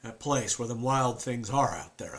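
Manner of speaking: a haunted Southern man; southern accent. Acted. Performs Luke with southern accent.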